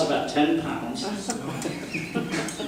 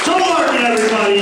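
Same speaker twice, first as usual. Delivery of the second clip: announcing name; 8 semitones higher, 4 dB louder, faster than usual. Real, not acted. Host calls name after set.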